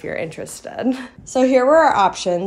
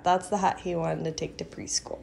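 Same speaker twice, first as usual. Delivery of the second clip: whisper; 4 semitones lower, 9 dB quieter than usual. Real, not acted. Whispering aside.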